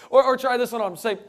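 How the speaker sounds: ordinary talk, nothing marked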